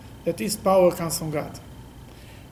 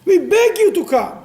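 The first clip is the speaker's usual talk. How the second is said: high, loud desperate plea to God; David pleading; 12 semitones higher, 7 dB louder than usual. Acted. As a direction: cry out to God, begging for help. Voices King David pleading with God.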